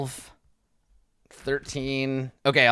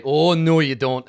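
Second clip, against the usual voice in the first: louder, drawn-out 'oh no'; forceful warning; 3 semitones higher, 7 dB louder, slower than usual. Acted. Durnan to the regenerating troll, forceful warning. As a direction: stop it in its tracks.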